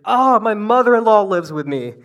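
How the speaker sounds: loud, high panicked realization; sudden dread. Retold or imitated. Peter realizing his mother-in-law will weigh in, panicked. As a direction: blurt it out in sudden horror. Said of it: "this other revelation hits Peter".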